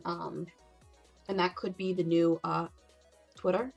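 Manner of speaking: ordinary talk, nothing marked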